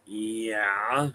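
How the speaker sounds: lightly imitated skeptic, low and quiet; drawn-out low retort, mildly mocking a doubter; a hint of an unconvinced listener; closed-off skeptic. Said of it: "he's got his arms closed".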